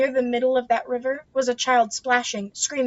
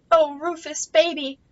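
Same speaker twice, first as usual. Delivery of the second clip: relieved cry; 5 semitones higher, 3 dB louder than usual. A narrator's reading. Mother hugging rescued son.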